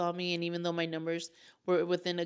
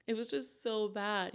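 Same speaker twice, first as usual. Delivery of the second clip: laughing through the words, slower; a bitter little laugh at how bad it was; rueful laugh; 4 semitones higher than usual. Real, not acted. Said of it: "laughs while saying it".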